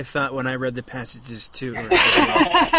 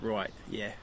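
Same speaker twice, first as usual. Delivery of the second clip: lower, trying out working-class English; lower-class English accent; 3 semitones lower, slower than usual. Acted. Co-host auditioning for the role of Killick, lower-class English accent. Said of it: "kind of a lower class English accent".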